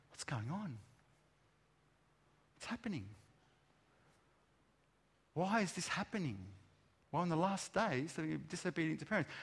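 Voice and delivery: softly, a parent asking what's going on; worried parent's quiet musing. Retold or imitated. Parent wondering about their children, quiet and worried. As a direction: murmur it like a puzzled, hurt parent.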